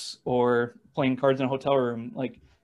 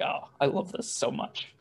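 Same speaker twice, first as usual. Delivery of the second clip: dry, breathy aside under the breath; sarcastic whisper; 6 dB quieter than usual. Retold or imitated. Batter walking to the plate under boos, sarcastic whisper.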